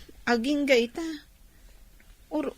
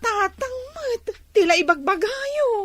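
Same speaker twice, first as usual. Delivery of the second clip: whiny sob; 9 semitones higher than usual. Acted. Daughter embarrassed by father's teasing.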